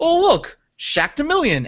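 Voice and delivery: lightly acted, high-pitched mock discovery; imitating Shaq. Retelling Shaq's move in a Scrabble commercial, mock discovery. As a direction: put on a high, innocent "look what I found". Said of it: "Shaq was just like".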